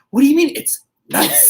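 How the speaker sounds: high, loud indignant wife; a wife mocking her husband's lukewarm verdict; mock outraged wife. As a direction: play the wife's disbelief, loud and high. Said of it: "She's like".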